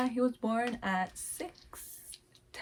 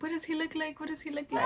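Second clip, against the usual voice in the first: higher, urgent asking; anxious eagerness; 7 semitones higher, 5 dB quieter, faster than usual. Retold or imitated. Mom begging to know what her baby looks like, higher. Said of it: "and I was like".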